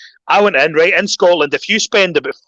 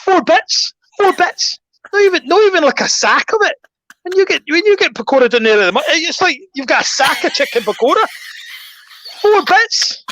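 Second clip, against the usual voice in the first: much higher, incredulous ranting about four bits; laugh-shout the outrage, pitched way up; exasperated rant; 11 semitones higher than usual. Retold or imitated. Ranting about tiny pakora portion.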